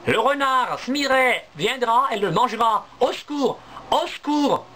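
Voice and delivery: high, urgent squirrel cries; frightened squirrels. Acted. Little squirrels cry down to Niels, high and urgent. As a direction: squeak out the alarm.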